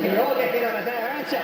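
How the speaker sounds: high, put-on ghost voice; haunt them as a comic ghost; ghostly voice. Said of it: "this ghostly voice go".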